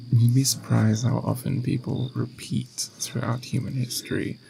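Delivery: ordinary talk, nothing marked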